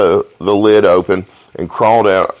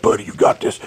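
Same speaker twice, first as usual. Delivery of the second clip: soft hushed buddy voice; calm him down, half whispered; mock calm buddy; 5 dB quieter than usual. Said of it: "He's like".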